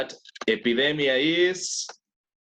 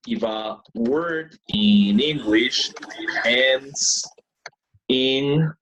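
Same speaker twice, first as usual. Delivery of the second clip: slow and louder, each word spaced for the class; slow explanation; 5 dB louder, much slower than usual. Shown as a demonstration. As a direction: spell it out slowly for the class. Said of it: "teacher slowing to explain".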